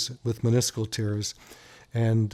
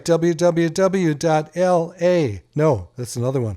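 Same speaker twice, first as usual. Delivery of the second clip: slow dictation; 4 semitones higher, 4 dB louder, slower than usual. Real, not acted. Dictating website on air.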